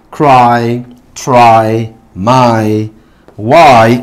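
cry, try, my, why each said alone with a long falling 'ai'; long I examples. Shown as a demonstration. Teacher reading a set of examples to learners, long I sound. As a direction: model cry, try, my, why as separate drill words. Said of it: "tenemos un set de ejemplos".

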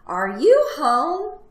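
loud, slow, rising call; high hollered question; yelling from outside. Acted. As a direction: call out to whoever is inside. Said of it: "someone yelled outside".

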